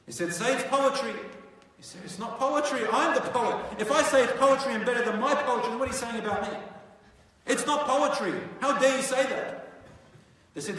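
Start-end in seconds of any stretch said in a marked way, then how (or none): 0.3-1.1 insistent demand. Acted. Leaders pushing the poetry claim. 2.0-9.7 loud, high, offended laughing scoff; scoff, you dare call me no poet?; indignant outburst. Acted. Al-Walid scoffing loudly at the leaders who suggest poetry.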